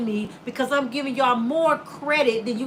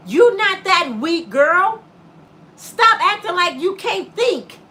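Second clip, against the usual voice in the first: ordinary delivery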